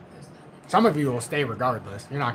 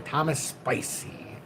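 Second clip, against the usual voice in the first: slow whisper; 6 dB quieter, much slower than usual. Retold or imitated. Rereading the misspelt name.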